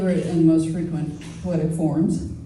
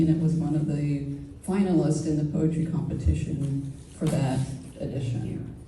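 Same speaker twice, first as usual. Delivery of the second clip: ordinary talk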